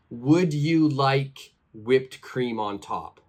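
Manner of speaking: "Would you like whipped cream on top?" with every word said apart and slow; each word separate. Shown as a demonstration. Teacher showing learners the wrong way, words said apart. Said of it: "So I don't say".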